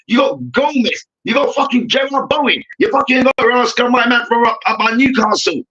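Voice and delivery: ordinary talk, nothing marked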